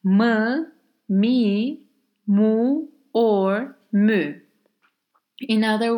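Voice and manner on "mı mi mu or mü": mı mi mu mü said clearly one by one; Turkish question particles. Shown as a demonstration. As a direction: sound out mı, mi, mu, mü slowly. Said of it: "the answer is adding".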